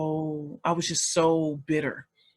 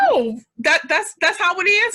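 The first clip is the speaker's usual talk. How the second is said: high, loud, stumbling surprise; surprised "oh", voice lifted high; acted-out realization; 11 semitones higher, 8 dB louder than usual. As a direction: imitate a child taken aback, pitched up. Child's surprised reply, acted out.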